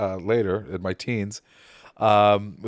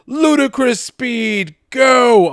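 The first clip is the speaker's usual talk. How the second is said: very high, loud, drawn-out yell; shouted movie command; 13 semitones higher, 11 dB louder, much slower than usual. Acted. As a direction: yell the launch order, stretching it out.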